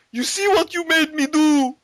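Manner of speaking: high, wailing mock blame; hammed-up wail at the vodka; mock Boris. Boris blaming the empty bottle, high and wailing. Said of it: "he's like".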